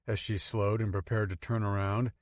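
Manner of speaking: ordinary delivery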